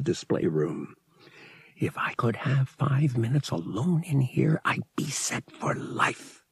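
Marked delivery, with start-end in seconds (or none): 1.8-6.4 soft unvoiced whisper, slowing at the end; wistful whisper. A narrator's reading. Pilot dreaming of robbing the collection, wistful whisper. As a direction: breathe it like a longing secret thought. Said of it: "he thought wistfully".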